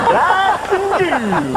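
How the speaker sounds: high-pitched call with a drawn-out end; public holler. Acted. Man standing on the subway platform just going for it, high loud holler. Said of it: "stand on the subway platform and just go".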